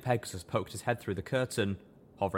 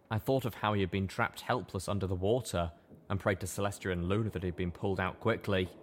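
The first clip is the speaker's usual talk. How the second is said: ordinary talk, nothing marked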